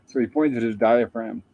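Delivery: ordinary delivery, nothing marked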